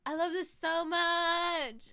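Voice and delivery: mock gushing, high and slow. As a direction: stretch it out with delight. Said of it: "you saying".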